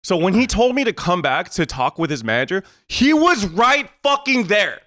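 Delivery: high, exasperated emphatic rant; indignant rant. Real, not acted. Indignant at being made to return.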